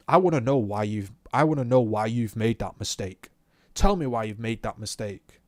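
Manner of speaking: loud, insistent mock instructor; retold angry demand. Instructor demanding answers from the learner, retold angry demand. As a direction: press the demand loudly, word by word.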